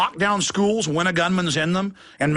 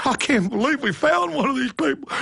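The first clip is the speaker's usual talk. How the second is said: hammed-up high, breathless alarm; mock a cop panicking over a find; hyperventilating panic; 5 semitones higher than usual. Police officer reporting an extremist, mock hyperventilating panic. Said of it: "start hyperventilating on video going".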